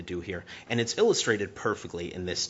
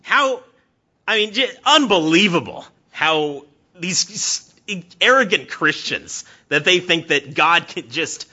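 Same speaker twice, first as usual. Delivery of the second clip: much higher and louder, contemptuous; scornful rant; 9 semitones higher, 9 dB louder, slower than usual. Retold or imitated. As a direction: sneer at those arrogant Christians. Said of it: "he goes".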